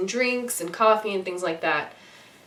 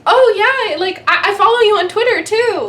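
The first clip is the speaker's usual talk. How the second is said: very high, loud starstruck babble; nervous gushing; 11 semitones higher, 7 dB louder than usual. Retold or imitated. Fan nervously telling actor she follows him. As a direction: blurt it out too eagerly. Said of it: "which was dumb".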